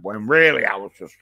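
normal talking voice

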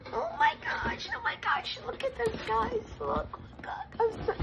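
high breathless whisper of wonder; excited whisper. Real, not acted. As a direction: hush it like a secret treasure. Peeking into the box.

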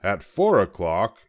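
ordinary delivery, nothing marked

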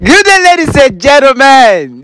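high, slow showman greeting over music; host announcer. Acted. Host opens show announcer style.

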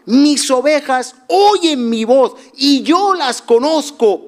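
high, slow preaching; preaching scripture. Acted. Preacher proclaiming Jesus' words to the congregation. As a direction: proclaim the verse slowly.

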